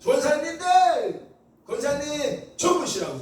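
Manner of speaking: high, loud, slow call to the room. Real, not acted. Preacher calling the deaconesses to look at him, loud and high. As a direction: call across the hall to get their eyes.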